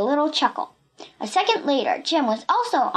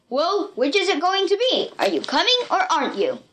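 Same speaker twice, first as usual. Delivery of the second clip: raised villain voice, demanding; impatient shout; 6 semitones higher than usual. A narrator's reading. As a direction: call out the ultimatum to her. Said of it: "He shouted to Pee-Pee".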